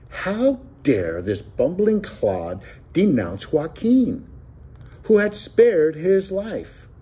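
slow, high-pitched outrage; indignant fury. A narrator's reading. Narrator recalling sudden fury at the clod who denounced Joaquin, indignant. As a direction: draw out the indignant disbelief. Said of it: "my sudden blind fury".

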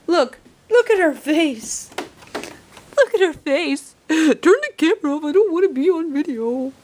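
high, loud excited chatter. Real, not acted. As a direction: talk up at the dog, thrilled. Excited filming the dog.

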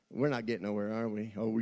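ordinary delivery

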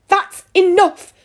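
high, loud outburst, drawn out; angry scream. A narrator's reading. Soaked daughter snaps at her parents, screaming. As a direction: scream it, stretching each word. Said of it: "she screamed".

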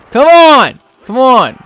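high, loud pleading yell; frustrated shout. Real, not acted. Shield losing health.

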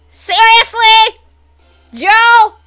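high, loud, drawn-out disbelief; exasperated shout. Real, not acted. Exasperated at opponent in the game.

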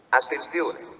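ordinary talk

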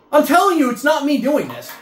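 ordinary talk

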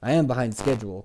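ordinary delivery, nothing marked

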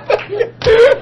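high, loud burst of laughter; real laughter. Real, not acted. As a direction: break up laughing at the memory. Laughing at own story.

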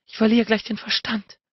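high-pitched, desperate plea; begging. A narrator's reading. Woman telling her lover she can't hold out, begging. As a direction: plead as if close to losing control. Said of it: "bettelte sie".

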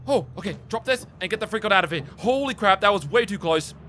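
panicked escape. Real, not acted. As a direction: hurry everyone onto the ship. Fleeing monster to ship.

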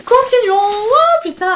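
high and loud burst of excitement; can't contain herself at the next item; overexcited outburst. Real, not acted. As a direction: shriek it high and loud with glee. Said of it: "il faut que je me calme".

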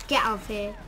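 normal talking voice

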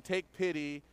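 ordinary talk, nothing marked

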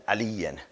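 علیون with the genitive tanween, ending "-en", low and soft. Shown as a demonstration. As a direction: close علیون on an "en" sound. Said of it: "تنوین جر یعنی ان".

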